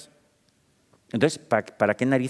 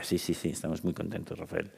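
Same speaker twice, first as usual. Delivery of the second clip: much softer, a quietly pleased boss; boss answering softly; 3 semitones lower, 10 dB quieter than usual. Retold or imitated. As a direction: answer in a hushed, mild reassuring voice. Jefa responde en la anécdota. (jefa contestando en voz muy baja)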